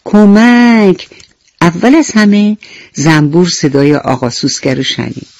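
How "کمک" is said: drawn-out call for help; cry for help. Acted. Beetle fallen in a pit calling out slowly for help. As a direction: stretch the word out slowly, calling for someone. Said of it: "با صدای بلند فریاد زد".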